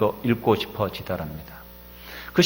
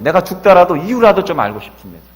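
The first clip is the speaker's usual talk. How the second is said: anguished plea; 8 semitones higher, 10 dB louder than usual. Retold or imitated. Dr. Kim's anguished plea to God.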